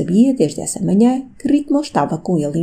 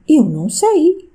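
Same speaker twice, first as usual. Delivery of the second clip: high, plaintive servant's plea, a touch voiced; pitiful voice; 10 semitones higher, 5 dB louder, slower than usual. Servant pleading ignorance to the countess, pitiful voice. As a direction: whimper it out like a cornered servant. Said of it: "com uma voz lamentável".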